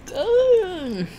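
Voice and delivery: high, delighted squeal on good. Real, not acted. Tasting wings, delighted.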